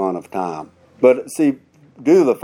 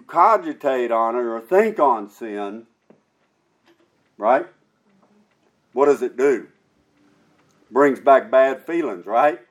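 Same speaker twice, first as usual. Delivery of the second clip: preaching; 4 semitones higher, 4 dB louder than usual. Real, not acted. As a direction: press the point to the congregation. Preaching to congregation.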